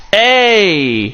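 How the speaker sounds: loud shout to get attention; calling out. Real, not acted. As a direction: call out across the room. Calling out to a viewer.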